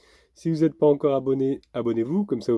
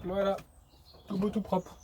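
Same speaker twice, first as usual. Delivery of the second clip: much higher, soft praise; talking to a dog; 9 semitones higher, 6 dB quieter than usual. Real, not acted. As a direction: gently praise the clean dog. (voix aiguë et douce pour le chien)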